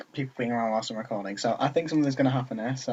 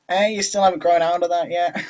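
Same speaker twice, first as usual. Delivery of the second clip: higher mocking quote in character; pitched-up imitation of judgemental friends; put-on character voice; 5 semitones higher, 6 dB louder than usual. Natsuki imitates friends judging her manga, in put-on voice. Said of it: "I hate this flipping voice I've put for it".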